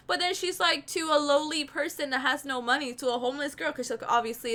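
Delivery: ordinary talk, nothing marked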